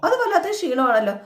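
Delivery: high, laughing taunt; mocking laugh. Husband teasing his wife about her tiredness, laughing mockingly. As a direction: laugh the words out in mock disbelief.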